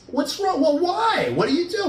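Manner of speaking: high, rapid-fire worried questions; alarmed questioning. Acted. Boyfriend reacting to his girlfriend's confession, alarmed. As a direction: imitate a rattled partner demanding answers.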